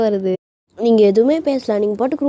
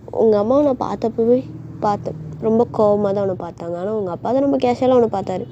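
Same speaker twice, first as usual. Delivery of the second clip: ordinary delivery, nothing marked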